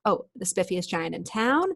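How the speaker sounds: ordinary delivery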